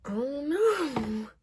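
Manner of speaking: slow, loud, high crying wail; whimpering cry. Retold or imitated. Caught by mom with a boy, slow loud crying wail. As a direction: wail it like a scared caught kid.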